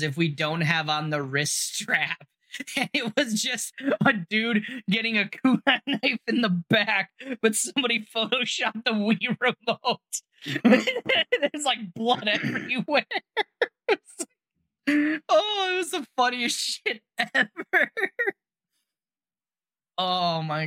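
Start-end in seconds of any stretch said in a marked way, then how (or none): 2.8-13.9 giggling through the words, pitch up; can barely get it out for laughing; real laughter breaking into the telling; laughing while talking. Real, not acted. Laughing while describing a meme. 14.9-17.9 high, fast, wheezing with laughter; crying with real laughter; helpless laughter. Real, not acted. Laughing fit over the meme.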